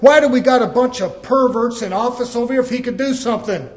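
loud, building challenge; thundering challenge to the church; preaching at full force. Acted. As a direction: hammer each question at the crowd. Preacher challenging the congregation loudly.

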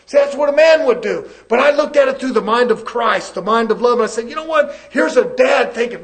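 impassioned pulpit delivery; forceful preaching. Real, not acted. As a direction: preach it loudly with urgency.

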